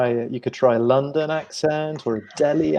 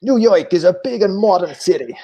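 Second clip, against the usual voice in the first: higher, put-on New York accent; 5 semitones higher, 4 dB louder than usual. Shown as a demonstration. Teacher showing students his impression, hammed-up New York accent. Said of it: "do my New York accent".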